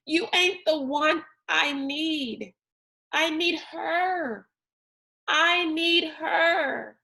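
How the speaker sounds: high, slowing, breaking into a cry; explosive grief. A narrator's reading. Rob raging at his father, ending in tears. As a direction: spit it out, then break down. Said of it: "coiled and explosive".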